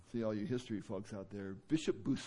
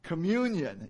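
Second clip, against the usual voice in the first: Communion said alone, loud and higher; emphatic; 7 semitones higher, 8 dB louder, slower than usual. Real, not acted. Preacher driving home Communion to the church. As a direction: hammer home Communion as one word. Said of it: "This comes from communion".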